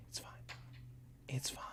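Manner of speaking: self-calming whisper. Real, not acted. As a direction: whisper to reassure yourself. Calming self while hiding.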